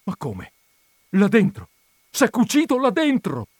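astonished, rising to a shout; stunned disbelief. A narrator's reading. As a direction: build from bewilderment to an incredulous cry. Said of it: "rimase dapprima come stordito".

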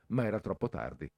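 ordinary delivery, nothing marked

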